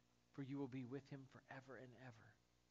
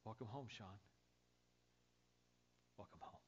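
softer, gentle, fading greeting; tender welcome; 3 semitones lower, 11 dB quieter than usual. Acted. Jesus greeting the pastor at heaven's gate, soft and tender. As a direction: say it quietly like a loving embrace. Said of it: "there's Jesus".

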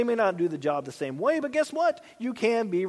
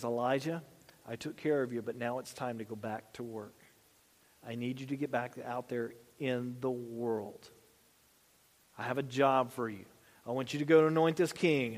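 gentle reassuring God; 6 semitones lower, 5 dB quieter than usual. Acted. God gently sending Elijah back.

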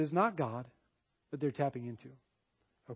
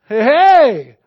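loud, higher, brushing it off; mock eager shout; 6 semitones higher, 19 dB louder than usual. Retelling his reply to the Spirit.

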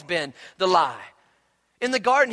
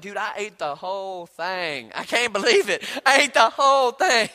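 overeater in disbelief; 4 dB louder than usual. Acted. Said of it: "you may be like".

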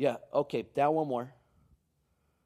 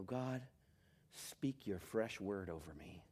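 much softer, low and humble; hushed prayer; 6 semitones lower, 11 dB quieter than usual. Acted. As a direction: pray it gently, almost under the breath. Quiet prayer after the plea.

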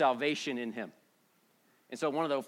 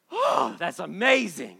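drawn-out, louder gush of wonder; slow, emphatic awe; awed amazement; 3 semitones higher, 6 dB louder, slower than usual. Acted. Preacher models how believers should react, amazed.

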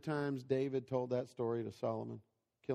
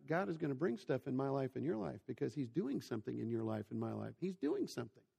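normal talking voice

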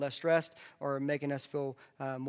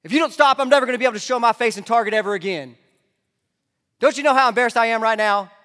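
put-on screaming at a misbehaving kid, high and loud; panicked embarrassed mom yelling in a store; frantic yelling parent; 7 semitones higher, 16 dB louder, faster than usual. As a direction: lose it completely, yelling high. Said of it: "the temptation is just to say".

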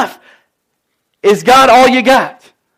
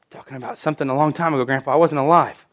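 quick, much softer, lower throwaway aside; a fast, low, much quieter joke aside; wry aside; 7 semitones lower, 8 dB quieter, faster than usual. Real, not acted. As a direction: tease it under your breath. Joking aside about grandpa.

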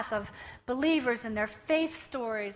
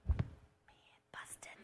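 whispered aside; 11 dB quieter than usual. Retold or imitated.

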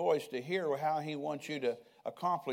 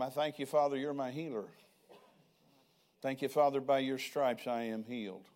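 lower, earnest prayer spoken aloud; 4 semitones lower than usual. Acted. Preacher praying over his family, lower and earnest. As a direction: pray it low and steady, thanking Father.

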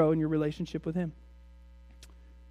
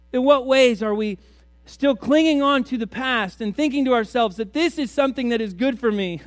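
ordinary talk, nothing marked

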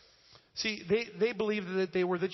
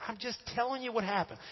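high-pitched, defensive protest; Peter; 6 semitones higher than usual. Acted. Apostle explaining himself to his critics, acted defensiveness. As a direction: plead innocence, voice pitched up. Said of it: "he's defending himself".

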